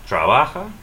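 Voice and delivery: "trabaja" as tra-BA-ha, rising; weight on "ba" in "trabaja"; stress next-to-last syllable. Shown as a demonstration. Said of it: "stress the next to the last syllable".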